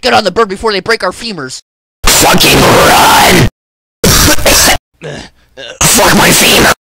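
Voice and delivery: ordinary delivery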